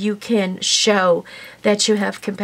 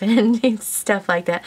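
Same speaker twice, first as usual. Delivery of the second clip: ordinary talk, nothing marked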